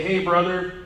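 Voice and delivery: a hint of a macho wrestler's growl, through a laugh; lightly voiced Hulk Hogan, half laughing; mock wrestler greeting, barely put on; bad Hulk Hogan impression. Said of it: "my really bad Hulk Hogan impersonation".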